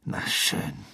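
low, soft, grumpy concession; disgruntled. A narrator's reading. Ron gives up questioning Ginny, disgruntled. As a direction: give in grudgingly, under your breath. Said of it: "der höchst missgelaunt aussah".

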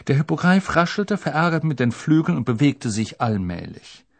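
ordinary delivery

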